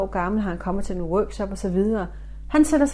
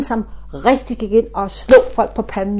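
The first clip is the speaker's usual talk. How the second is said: ordinary talk, nothing marked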